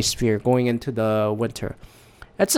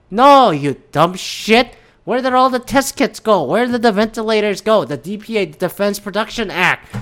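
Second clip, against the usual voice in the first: mock angry retort; 6 semitones higher than usual. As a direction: chew him out, sarcastic and exasperated. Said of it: "I go like".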